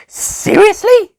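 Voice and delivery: very high, slow, drawn-out disbelief; sarcastic. A narrator's reading. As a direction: stretch it out mockingly. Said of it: "she asked sarcastically".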